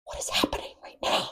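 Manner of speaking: breathless whispered disbelief; stunned whisper. Retold or imitated. Watching the twist with Danae, whispered disbelief. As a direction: breathe it out in shock. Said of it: "we're like".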